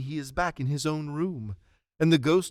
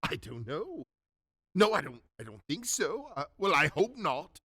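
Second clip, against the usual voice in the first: swooping pitch, bursting into laughter; giddy, overjoyed Scrooge; 4 semitones higher than usual. Acted. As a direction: relief bubbling over into giddy glee.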